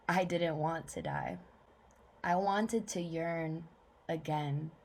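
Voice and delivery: restrained spoken-word reading in short phrases; measured poetry reading, falling line ends; poem recital. Acted.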